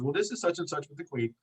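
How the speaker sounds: retold lady's British accent. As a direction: imitate a polite British caller, softly. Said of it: "this lady with a very ... Kingdom accent".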